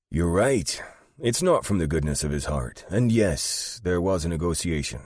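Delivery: firm, emphatic character delivery; Albie, knowing and frank. Acted. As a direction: confirm it with emphasis. Narrator voices Albie.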